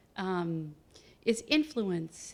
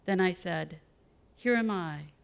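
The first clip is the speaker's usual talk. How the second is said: louder, measured public reading of scripture; 6 dB louder than usual. Acted. Poet reads Isaiah to the audience, solemn scripture reading. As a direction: read it out firmly, phrase by phrase.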